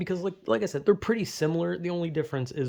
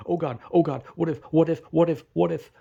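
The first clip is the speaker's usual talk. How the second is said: imitating his own panic, a bit louder; breathless mock panic over what might happen; panicked; 3 semitones higher, 4 dB louder than usual. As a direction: act out a frantic what-if spiral. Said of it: "And I'm panicked because I'm overthinking".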